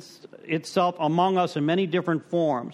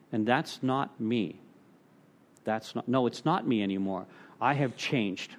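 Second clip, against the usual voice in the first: quiet sincere quote; 7 semitones lower, 6 dB quieter than usual. Retold or imitated. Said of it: "And you know what I said?".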